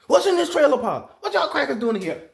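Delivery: high, loud mock indignation; comic bullying storm voice; tornado as Tasmanian devil. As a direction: burst in hollering, hammed up. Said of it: "When that goddamn tornado come, like the Tasmanian devil".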